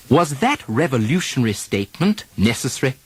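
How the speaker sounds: British RP model sentences, -ary vowels dropped. Shown as a demonstration. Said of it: "Try it in these sentences".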